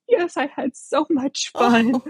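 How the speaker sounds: very high, slow imitation of a little boy; tearful happy boy. Little boy answering after the ride, high imitated kid voice. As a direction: mimic a thrilled kid just done crying. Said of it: "he just wipes his tears and goes".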